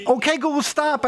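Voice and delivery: high urgent command to the speaker; frantic, urgent stop command; repeating to voice assistant. Real, not acted.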